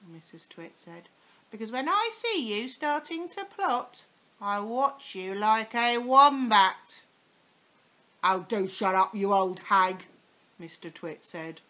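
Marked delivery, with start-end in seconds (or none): 1.5-7.0 louder, higher, slowing at the end; warning old hag. Acted. Mrs Twit warning husband. 8.2-10.1 loud, gruff dismissive snap; grumpy old husband. Acted. Mr Twit snapping at wife.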